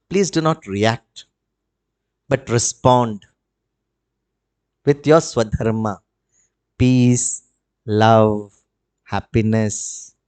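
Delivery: low, slow, measured counsel; soothing low-pitched plea for peace; calm appeal. Real, not acted. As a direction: let each word settle, with long pauses. Gentle plea for peaceful response.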